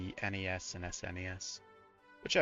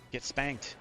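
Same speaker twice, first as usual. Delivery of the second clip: ordinary talk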